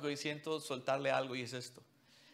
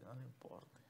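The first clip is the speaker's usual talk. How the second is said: whispered; 3 semitones lower, 22 dB quieter than usual. Shown as a demonstration. Preacher urging the congregation, whispered. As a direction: whisper the repeat of no le importe.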